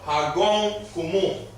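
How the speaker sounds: slow, careful Hagong Kumu; slow model phrase. Shown as a demonstration. Teacher repeats the phrase slowly so students can copy. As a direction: spell it out slowly for the class.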